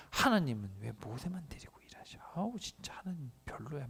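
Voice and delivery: whispered grumbling behind God's back; sulky older siblings muttering about God. Acted. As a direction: hiss the complaint under your breath.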